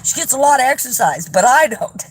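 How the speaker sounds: can't stop giggling while telling it; real laughter breaking into the words; laughing. Real, not acted. Laughing about dog's walks.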